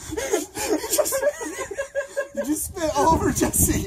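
high, giggly, laughing through words; cracking up while talking. Real, not acted. Water spat, both laughing.

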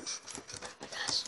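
breathy whispered gasp; whispering. Real, not acted. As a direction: whisper it in awe. Whispered reaction.

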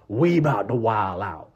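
slowed, punched-up declaration; drawn-out mock battle cry; first-person rowdy boast. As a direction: announce it as one of the rowdy crowd. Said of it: "people saying it in first person".

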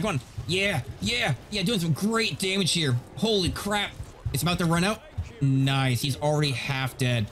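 ordinary delivery

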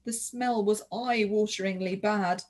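usual speaking voice

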